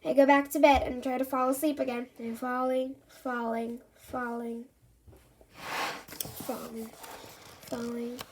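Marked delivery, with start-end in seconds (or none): 2.2-8.2 fading from soft to almost silent; drifting off. A narrator's reading. Andy falling asleep in bed, voice fading away.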